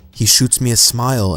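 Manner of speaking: usual speaking voice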